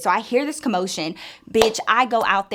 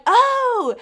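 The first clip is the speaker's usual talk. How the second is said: high startled oh; shocked oh; 5 dB louder than usual. Retold or imitated. Seeing Armando beside Samantha, high shocked oh. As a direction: catch sight of him and freeze. Sees Armando next to friend.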